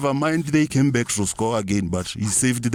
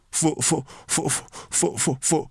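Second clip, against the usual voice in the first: high stammer; 7 semitones higher, 4 dB quieter, slower than usual. Acted. Stammering in comic indignation.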